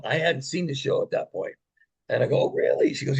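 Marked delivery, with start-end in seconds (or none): 2.4-2.9 high-pitched retold surprise; surprised. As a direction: react with a rising, intrigued lilt. Retold reaction to surprising offer.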